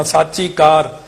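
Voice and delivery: ਸਾਚੀ with long aa, "saachi"; correct long vowel. Shown as a demonstration. Teacher correcting the line, ਸਾਚੀ with long aa. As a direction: open out the aa of ਸਾਚੀ. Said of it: "ਇੱਥੇ ਸੱਸੇ ਨੂੰ ਕੰਨਾ".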